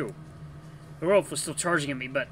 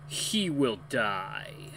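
ominous slow voice; 4 semitones lower, 4 dB quieter, much slower than usual. Acted. Mysterious unseen voice speaks.

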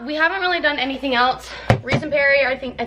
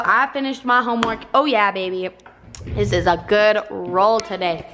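ordinary talk

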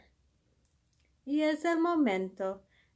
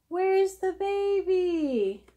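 high, slow singsong peekaboo; 6 semitones higher, slower than usual. Acted. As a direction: ask it like peekaboo. Playing peekaboo with the baby.

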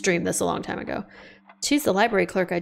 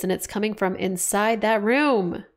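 ordinary talk

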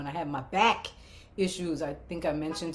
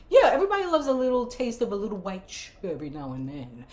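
ordinary delivery

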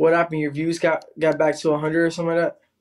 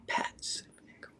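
breathy whisper, barely voiced; 19 dB quieter than usual. Real, not acted. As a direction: whisper the words. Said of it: "I like the way you do that".

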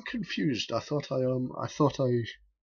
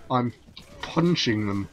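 usual speaking voice

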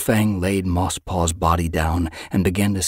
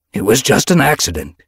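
raised, louder address; public reassurance; 5 semitones higher, 8 dB louder than usual. A narrator's reading. Leader to shocked clan, loud reassurance. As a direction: project it over a murmuring crowd.

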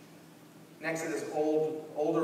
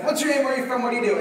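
rapid-fire, much higher and louder questions; quizzing a stranger, higher and much louder; brisk bouncer quiz; 8 semitones higher, 9 dB louder, much faster than usual. Retold or imitated. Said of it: "he's like".

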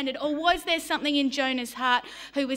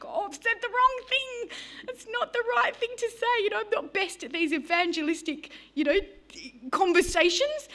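high, flustered self-talk in a hurry; panicked inner thought; 6 semitones higher than usual. Retold or imitated. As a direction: play the sudden fear of a blunder, pitched high. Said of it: "And I was like".

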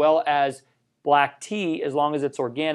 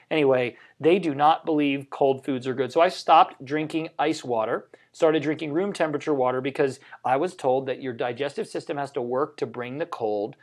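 ordinary talk, nothing marked

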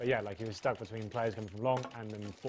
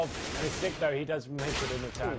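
esports caster; 4 semitones higher, 7 dB louder than usual. Real, not acted. Live CS play-by-play.

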